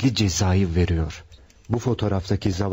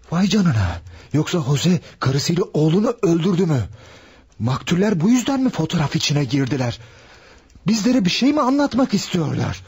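excited realisation; 7 semitones higher than usual. Acted. Sergeant realises the murder.

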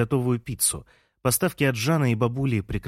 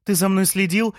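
high, raised-voice challenge; shouted accusation; 9 semitones higher, 4 dB louder than usual. A narrator's reading. Лея accuses Жан of following her, crying out high. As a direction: cry out in indignant disbelief. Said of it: "закричала Лея".

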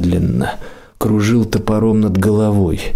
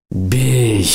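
shouting. A narrator's reading. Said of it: "закричал Олег громко".

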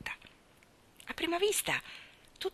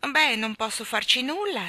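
apologetic; 6 semitones higher, 4 dB louder than usual. Acted. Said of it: "si scusò il signor Sotto".

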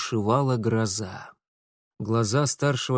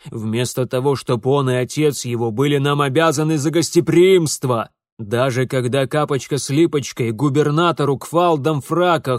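loud rant building to outrage; indignant tirade; 7 dB louder than usual. Acted. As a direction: rail at the disgrace ever louder. Father rants about family disgrace.

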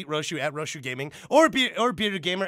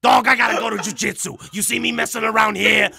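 loud, higher, badgering character voice; impression of loud ranter; 4 semitones higher, 7 dB louder than usual. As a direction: rant at a coworker at full volume. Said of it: "That's how he sounds".

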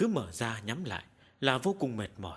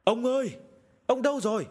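high, raised call for a missing husband; loud calling out; 9 semitones higher, 5 dB louder than usual. A narrator's reading. Worried wife searching the house for her husband, calling loudly. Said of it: "cất tiếng gọi lớn".

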